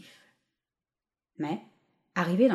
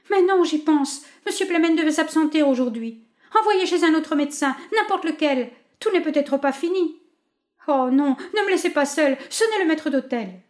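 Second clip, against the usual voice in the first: high, loud, frantic pleading; panicked; 9 semitones higher, 5 dB louder than usual. A narrator's reading. Wife begging the maid for help, frantic high voice. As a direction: beg the maid in rising panic.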